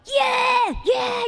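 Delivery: high, loud drawn-out cheer; mock fan cheering. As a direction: holler like an excited race crowd. Imitating fans cheering a longshot jockey.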